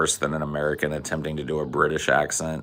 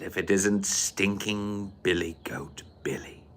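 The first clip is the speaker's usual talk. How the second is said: hushed, sneering mock British; Clockwork Orange accent; 9 dB quieter than usual. Greeting Billy Boy in the film, hushed mock British. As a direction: drop to a soft British near-whisper. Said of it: "Do a Clockwork Orange accent".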